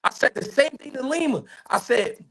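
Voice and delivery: ordinary talk, nothing marked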